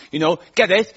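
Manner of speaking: ordinary talk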